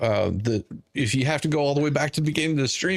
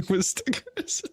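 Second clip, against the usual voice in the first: laughing echo; 12 semitones higher, 4 dB quieter, slower than usual. Real, not acted.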